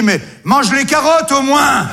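mock exclamation. Cannibal retorts to the other.

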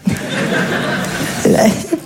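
petit rire soufflé; rire étouffé. Real, not acted. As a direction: rire sans voix. Rire après la réplique. (rire soufflé, retenu)

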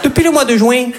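put-on high, singsong accent du Midi; southern French accent. New York romcom couple dubbed in a southern French accent. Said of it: "avec l'accent du Midi".

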